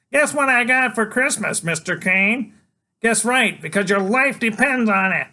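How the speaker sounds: put-on high, loud cocky little boy; a tiny hero bluffing a killer; bold kid voice. Freak bluffing Killer Kane, high and loud. Child voice.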